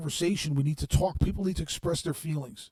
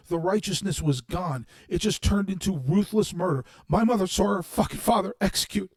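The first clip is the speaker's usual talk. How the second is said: rising furious rant, louder as it builds; angry rant; 3 semitones higher, 4 dB louder than usual. Real, not acted. Ranting at online critics about kumbaya, angry and loud. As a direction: let the anger boil over as you speak. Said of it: "I'm angry right now".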